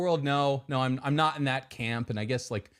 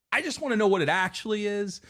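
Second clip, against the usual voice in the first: ordinary talk, nothing marked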